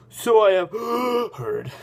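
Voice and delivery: hammed-up gasp mid-sentence; out of breath. A narrator's reading. Old man replying on the climb, out of breath. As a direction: start loud, suck in air, drop off. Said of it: "He's just gonna have a very breath".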